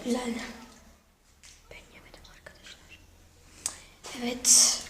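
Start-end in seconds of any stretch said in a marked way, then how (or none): 1.7-3.0 quick confiding whisper. Real, not acted. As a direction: whisper it to the camera, fast. Secret aside to camera.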